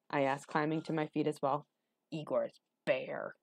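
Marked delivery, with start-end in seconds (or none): 2.1-3.4 slow, soft gravelly Igor; rough and unhurried; low gravelly voice. A narrator's reading. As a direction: draw it out in a rough hunchback voice. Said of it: "His voice was low and gravelly".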